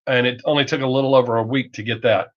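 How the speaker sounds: usual speaking voice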